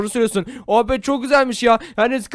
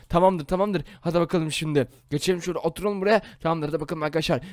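hushed plotting; 7 semitones lower, 6 dB quieter than usual. Acted. Plotting quietly before prank.

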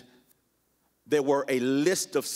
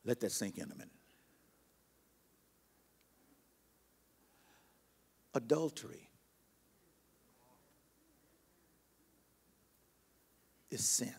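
grave, hushed pulpit pauses; hushed gravity; 12 dB quieter than usual. Real, not acted. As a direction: drop almost to a whisper and let each word sink in. Lets weighty point sink in.

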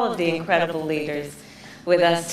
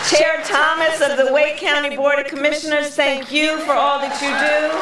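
raised, higher projecting rally voice; rally speech; 8 semitones higher, 6 dB louder than usual. Acted. Vice president thanking local leaders at rally, projecting speech. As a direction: address the crowd like a stump speech.

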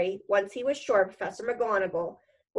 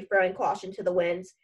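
ordinary delivery, nothing marked

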